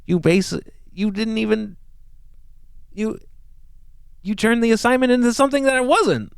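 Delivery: lightly imitating a displeased professor; scolding professor. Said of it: "because he was like".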